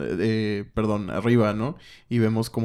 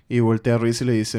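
ordinary delivery

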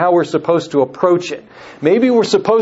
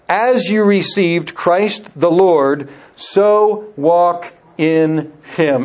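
slow reading; much slower than usual. Shown as a demonstration. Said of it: "reading scripture slowly".

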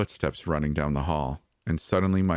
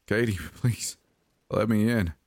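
higher, tearful plea; nearly sobbing; 4 semitones higher than usual. A narrator's reading. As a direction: beg as if close to tears. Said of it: "he was nearly sobbing now".

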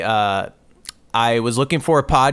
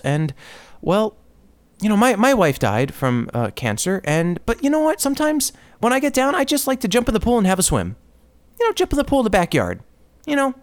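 lightly mocking, higher, gentle positive-guru voice; soft-spoken inspirational podcaster; 7 semitones higher than usual. Comedian mocks an upbeat podcast host, higher and gentle. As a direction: imitate the syrupy podcast guy, a touch sing-song. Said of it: "he's like".

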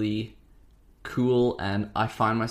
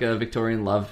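ordinary talk, nothing marked

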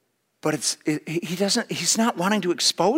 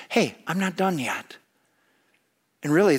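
usual speaking voice

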